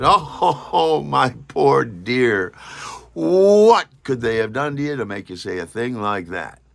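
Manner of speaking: glib mock pity. Teasing Deirdre with mock pity. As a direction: tease her with fake pity. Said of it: "I thought I was being glib".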